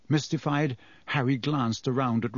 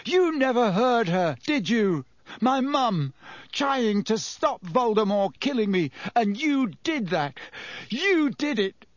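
shaking, high-pitched anguish; angry grief; 8 semitones higher than usual. Acted. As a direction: hurl the blame, voice cracking.

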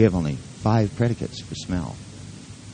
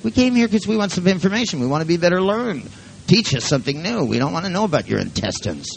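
mock exasperated retort; 11 semitones higher than usual. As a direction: play an audience fed up with hearing about feelings. Exasperated retort to nervous volunteers.

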